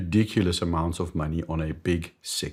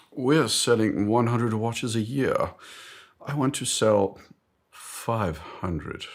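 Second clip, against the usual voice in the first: higher, slow mock-British executive; British boss; 4 semitones higher, slower than usual. British boss pitching growth to the boardroom, mock-British, deliberate. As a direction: put on a pompous British accent, drawn out. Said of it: "I d-, don't know why he's British".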